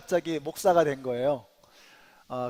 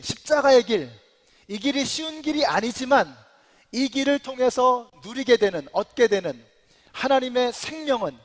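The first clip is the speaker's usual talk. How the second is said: raised, ringing pulpit delivery; impassioned preaching; 5 semitones higher, 4 dB louder than usual. Real, not acted. Pastor urging the congregation along the hard road, impassioned. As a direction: drive each phrase home, higher and emphatic. Preaching builds to emphatic climax.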